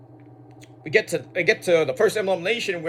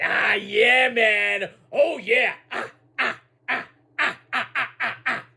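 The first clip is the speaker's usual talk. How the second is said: imitating Booker T; 3 semitones higher than usual. Mimicking the excited commentator about the new arrival, high yelps. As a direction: ham up a hyped commentator. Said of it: "Booker T's going, he's like".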